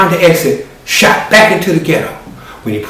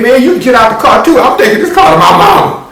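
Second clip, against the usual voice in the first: higher, loud and fast; frantic order; 10 semitones higher, 5 dB louder than usual. Acted. Marquette telling his cousin to get out, loud high rush.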